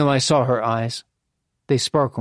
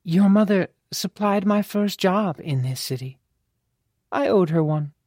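lightly voiced courtesan, pitched up; a hint of a higher female character voice; grave courtesan; 6 semitones higher than usual. Voices Babette in narration.

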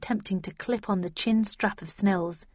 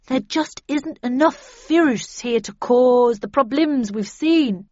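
raised, loud and slow; puzzled character voice; 4 semitones higher, 6 dB louder, slower than usual. A narrator's reading. Snell to colleagues about the virus, slow puzzled voice, pitched up. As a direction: reason it out slowly, voice pitched up.

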